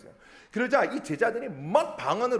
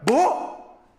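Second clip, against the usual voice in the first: loud, high startled question; acted astonishment; 8 semitones higher, 7 dB louder than usual. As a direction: react as a listener who can't believe it. 놀란 청중의 반응 연기. (깜짝 놀라 크게 되묻기)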